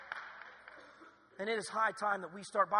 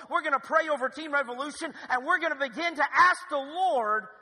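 loud, high preaching voice; full-force preaching; 6 semitones higher, 8 dB louder than usual. Acted. Pastor urging the church to pray, preaching loud. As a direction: drive it home to the congregation.

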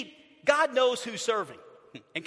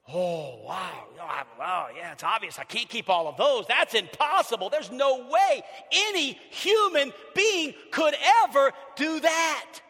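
hushed wow building to a loud cry; overwhelmed realization; 3 dB louder than usual. Pretend. Rich young ruler realizing he cannot keep the law, building to a shout. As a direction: start awed, end crying out it's impossible. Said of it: "what should that rich young ruler have said then?".

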